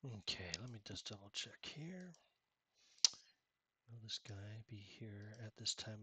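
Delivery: whispered aside to self. Real, not acted. Game master checking notes, whispering to himself.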